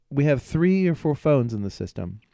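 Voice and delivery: ordinary talk, nothing marked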